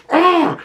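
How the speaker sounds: high, sharp groan of frustration; exasperated groan. Real, not acted. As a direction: let out a fed-up "ugh". Fed up with the filmmakers.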